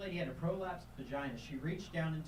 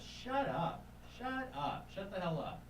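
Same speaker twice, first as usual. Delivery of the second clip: higher, curt shut up; sergeant snapping; 6 semitones higher, slower than usual. Retold or imitated. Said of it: "my sergeant goes".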